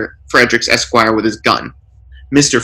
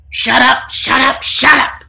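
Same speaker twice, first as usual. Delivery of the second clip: much higher, building shouts; screaming burglar; 13 semitones higher than usual. A narrator's reading. Burglar screams at the lawyers, much higher and building. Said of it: "screams the burglar".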